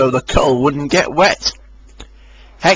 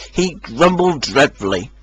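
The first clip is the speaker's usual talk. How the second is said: usual speaking voice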